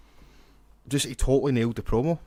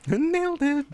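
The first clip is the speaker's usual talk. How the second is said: high nerdy echo; squeaky nerd imitation; slower than usual. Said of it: "repeating in nerdy voice".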